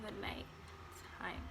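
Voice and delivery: slow unvoiced whisper; dramatic whisper. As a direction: drop to a spooky hush. Dramatic build to nighttime.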